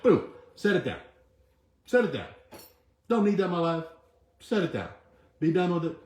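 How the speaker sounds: firm, emphatic urging; forceful command. Real, not acted. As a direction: drive it home like a coach. Urging listeners to drop worries.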